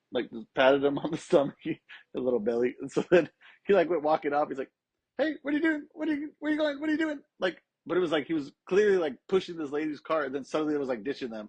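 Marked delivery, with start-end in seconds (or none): 5.2-7.2 much higher, squeaky flustered dad; startled high-pitched mock dad; imitating dad caught out. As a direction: play the busted dad, high and rattled. Dad caught, questions son nervously.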